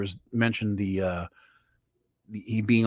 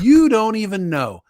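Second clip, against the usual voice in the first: mocking Jimmy; 8 semitones higher, 7 dB louder, slower than usual. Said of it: "all those people he said".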